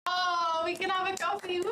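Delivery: thrilled high cheer over a free coffee; excited. Real, not acted. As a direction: squeal with delight at the news. Delighted at coffee money.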